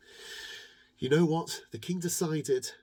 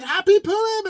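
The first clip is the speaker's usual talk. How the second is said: much higher, louder festive shout; joyful shout; 13 dB louder than usual. Acted. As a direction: call it out to the whole town. King greets everyone.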